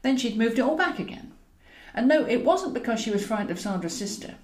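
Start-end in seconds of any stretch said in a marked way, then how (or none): none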